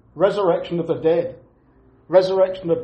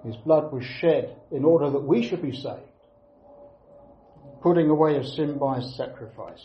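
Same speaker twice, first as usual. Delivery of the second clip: ordinary talk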